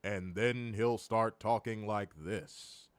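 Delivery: low, slow, hushed office-cubicle voice; Joe Tessitore cubicle voice. Retold or imitated. Podcaster mocking announcer's sudden switch, low cubicle voice. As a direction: drop down soft and drawn out, mocking an announcer. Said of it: "that fucking office cubicle voice".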